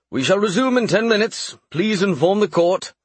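high, carrying royal call; king calling out. Acted. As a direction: announce it to the court. King calls out orders.

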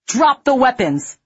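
loud, high roared command. A narrator's reading. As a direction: roar the order at them. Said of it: "McNulty roared".

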